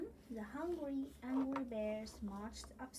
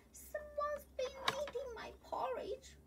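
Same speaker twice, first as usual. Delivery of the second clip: much higher, mock-gruff bear voice; growling Papa Bear; 14 semitones higher than usual. Papa Bear finding his porridge eaten, mock growl. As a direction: play the cross Papa Bear, lightly growled. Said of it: "growled Big Papa Bear".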